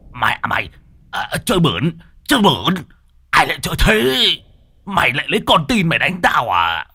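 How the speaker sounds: high, loud, indignant protest; a furious old sorcerer crying foul; outraged cursing. A narrator's reading. Sorcerer Môn Phọt protests the demon's dirty trick, high and outraged.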